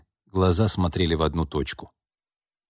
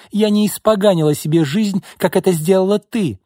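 high, loud taunting voice; acted scornful jab; smirking; 10 semitones higher, 5 dB louder than usual. A narrator's reading. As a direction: sneer at your sister's failed life. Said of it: "Изольда усмехнулась".

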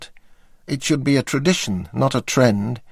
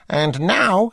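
nasal David Frost voice; 7 semitones higher than usual. Retold or imitated. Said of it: "he intoned in his intense nasal voice".

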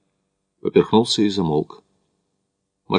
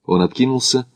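ordinary delivery, nothing marked

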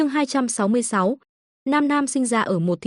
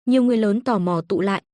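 usual speaking voice

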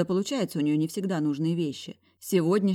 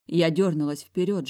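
ordinary delivery, nothing marked

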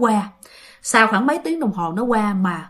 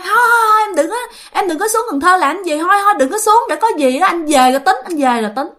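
retold protest, high at first then easing; urgent dissuading; 7 semitones higher than usual. Boyfriend stops girlfriend coming to Cần Thơ, urgently dissuading. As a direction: wave them off in an urgent high voice, settling down. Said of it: "nó cản các bạn".

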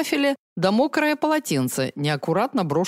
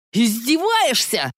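high, slow indignant snarl; fierce growl; 5 semitones higher, slower than usual. Acted. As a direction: growl it out furiously. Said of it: "свирепо рыкнула я".

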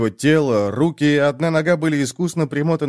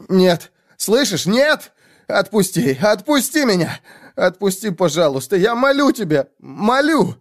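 high, frantic pleas; desperate begging; 8 semitones higher than usual. A narrator's reading. Bound man begs the girl to let him go, desperate. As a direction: beg for release in rising desperation. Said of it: "то начинал её умолять".

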